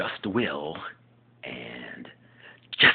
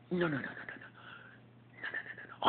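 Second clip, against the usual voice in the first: much softer hushed no's; Jack mumbling no; 9 semitones higher, 12 dB quieter, faster than usual. Acted. Jack backing down quietly to Will. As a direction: mutter the no's under your breath. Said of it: "impersonation of ... Just Jack".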